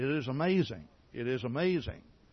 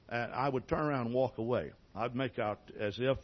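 usual speaking voice

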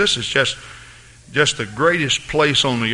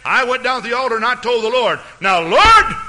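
higher, fast, the Now Lord louder; mocking brash prayer; 11 semitones higher, 5 dB louder than usual. Preacher mimicking a brash person scolding the Lord, louder and higher. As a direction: mimic a cocky believer ordering God. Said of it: "I've even heard people say".

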